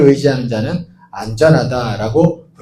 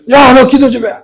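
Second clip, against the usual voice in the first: loud, higher command; boss's order; 9 semitones higher, 8 dB louder than usual. Retold or imitated. Boss telling him to pray, loud shout.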